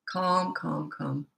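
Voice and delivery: soft, soothing repetition; calm. Shown as a demonstration. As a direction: settle it down softly, word by word. Demonstrating calm response to tantrum.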